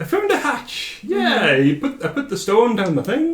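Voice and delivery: high-pitched Welsh lilt; sing-song mock Welsh, pitched high; attempted Welsh accent. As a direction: play the character in amateur Welsh. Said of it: "this Welsh accent that's about to happen".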